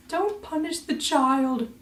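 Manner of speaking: very high, slow mocking old-man voice; mock grandfather. Grandfather answering the plea, slow mock-solemn high voice. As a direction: drag out the line in a silly high voice. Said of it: "And it's like".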